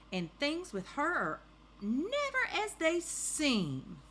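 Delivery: high, slow suspicious warning; grumpy neighbor. Acted. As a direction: draw it out as the disapproving storybook neighbor. Grumpy neighbor, voiced in story.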